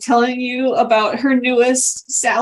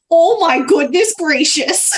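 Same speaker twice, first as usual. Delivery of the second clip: put-on lilting, higher-pitched exclaiming dear old acquaintance; Greta's exclamation; 5 semitones higher than usual. Greta calls from behind the players, exclaiming. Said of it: "from behind you, you hear".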